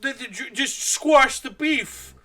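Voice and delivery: high, hammed-up preachy voice; mocking advice-givers. Mocking people who say squash the beef, high and preachy. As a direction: mimic the smug peacemakers, high-pitched. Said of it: "people always say".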